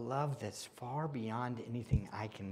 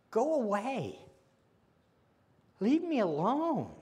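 loud, high, pleading voice pushing people away; anguished cry; 12 semitones higher, 10 dB louder than usual. Acted. A preacher voicing a hurting soul crying out. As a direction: cry out to be left alone. Voicing those who want to hide.

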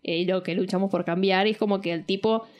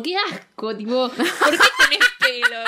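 a rising, grossed-out boss lady, higher and louder by the end; disgusted woman; 9 semitones higher, 6 dB louder than usual. Retold or imitated. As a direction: act out her disgust as she says it. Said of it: "le dice como".

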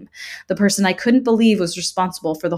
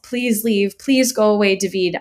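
raised, tearful plea; begging through sobs; 4 semitones higher, 6 dB louder than usual. A narrator's reading. Child curled up begging David, tearful pleading. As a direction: beg to be left alone, near sobbing. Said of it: "I begged through ragged sto-, sobs".

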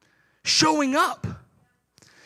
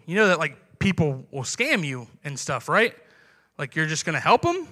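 lower, patronizing warning; mock condescension; 8 semitones lower, 4 dB quieter than usual. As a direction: pastor voicing a skeptic warning about scammers, lower and patronizing. Outsider warns of scammers.

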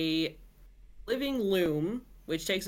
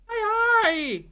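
much higher, louder cry of pain; pained scream; 8 dB louder than usual. A narrator's reading. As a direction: yelp as the stone hits. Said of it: "he screamed".

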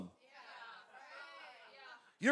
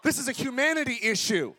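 preaching at full force; 4 semitones higher, 3 dB louder than usual. Acted. Pastor announces he feels like preaching, then shouts louder. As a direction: preach it hard to the room. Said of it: "I feel like preaching today".

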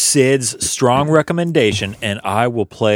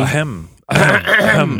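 ahem climbing higher each time; rising throat clears; 5 semitones higher than usual. Shown as a demonstration. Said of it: "Vocal exercises, everybody".

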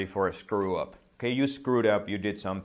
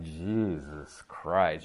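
exasperated; 4 semitones lower, much slower than usual. Retold or imitated. Kids react to the scene.

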